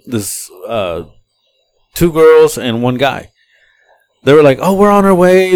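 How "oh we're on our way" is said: higher, louder passenger voice; raised, lively mimicry; imitated calling out. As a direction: call out like riders on their way. Retold passengers calling to the driver.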